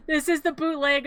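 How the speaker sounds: breaking into giggles mid-sentence; laughing while talking. Real, not acted. Storyteller cracking up over Jack's song, laughing. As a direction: try to carry on through real laughter.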